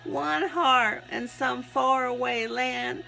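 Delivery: slow, emphatic recitation with measured pauses; slow inspirational poem reading; devotional reading. Acted. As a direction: read your testimony aloud, phrase by phrase. Reads written devotional piece aloud.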